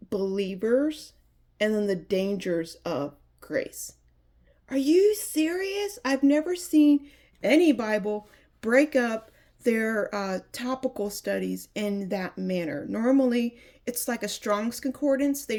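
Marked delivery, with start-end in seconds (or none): 4.7-10.1 surprised. Real, not acted. Amazed at the Bible layout.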